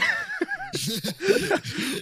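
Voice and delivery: long, very high cackle; high-pitched laugh. Real, not acted. Streamer laughing at his drunk-friend imitation, high cackle. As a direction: crack up at your own impression.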